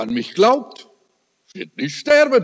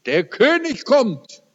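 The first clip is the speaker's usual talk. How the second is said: higher-pitched, excited dying wife's cry; excited retelling; 8 semitones higher than usual. Retold or imitated. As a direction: retell her thrilled announcement, pitch leaping up. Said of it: "sagt sie ganz aufgeregt".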